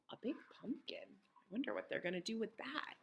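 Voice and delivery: gasp, then wonder under your breath; very soft, intrigued whisper; hushed wondering. Real, not acted. Hushed aside wondering about pumpkin.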